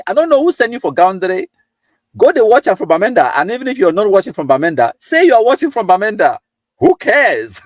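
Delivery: high, teasing scold at a viewer; mock indignation. Real, not acted. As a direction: pretend outrage, playful and high-pitched. Teasing viewer watching from Gaundere.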